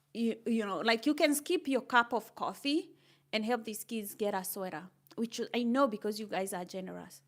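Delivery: normal talking voice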